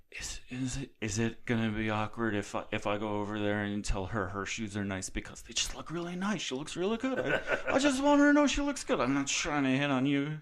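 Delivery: put-on tipsy, slurry rambling drunk. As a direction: play it like a giddy drunk guy. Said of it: "It's a great drunk voice".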